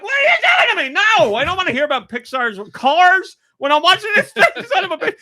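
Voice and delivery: high-pitched laughing tirade at the autoplay; laughing outrage. Retold or imitated. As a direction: squeal at the screen through laughter. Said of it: "I'm like".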